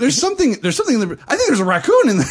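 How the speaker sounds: loud, high panicked stammer, laughing; frantic. Retold or imitated. Girlfriend thinks a raccoon is inside, frantic. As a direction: imitate a girlfriend in a comic panic.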